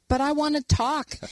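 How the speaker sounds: mildly needy, acted-out protest; pleading complaint. Wife to husband on the couch, pleading complaint. Wife acting out wanting attention.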